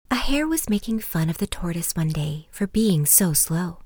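lively storyteller reading aloud; bedtime story narration. A narrator's reading. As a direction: read it like a children's fable. Switches to louder story reading.

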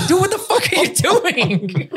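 high, laughing what are you doing; high laughing yell at the friend who moved; laughing disbelief. Retold or imitated. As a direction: call out to a friend mid-laugh.

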